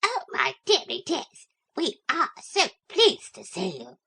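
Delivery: adoring little dog overflowing with joy; gushing little dog. Acted. Brenda gushes to her master and mistress, softly shrill. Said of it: "in a softly shrill, excited voice".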